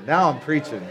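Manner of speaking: chuckling through the words; giddy echo of the boast; laughing repeat. Real, not acted.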